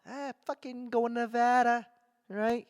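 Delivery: high-pitched put-on Boston guy; Boston accent. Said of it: "that's so Boston".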